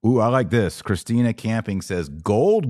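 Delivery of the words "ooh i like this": ordinary talk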